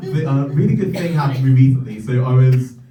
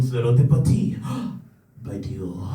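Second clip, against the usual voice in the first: mock French voiceover; 5 semitones lower, 5 dB quieter than usual. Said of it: "The voiceover comes on".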